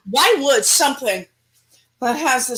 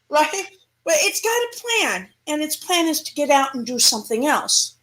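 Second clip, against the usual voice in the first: ordinary talk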